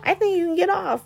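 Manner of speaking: mock clueless lawyer. Bad lawyer addressing Spencer, mock clueless. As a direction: sound totally lost in court. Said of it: "She was just like".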